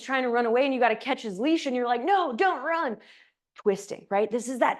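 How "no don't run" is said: higher-pitched call to a runaway dog; acted-out urgent plea; mock urgent call. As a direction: playfully call the dog back. Calling after runaway dog.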